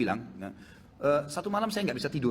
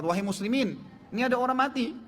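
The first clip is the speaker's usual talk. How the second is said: calling out; 8 semitones higher than usual. Acted. Sultan calls out to passersby.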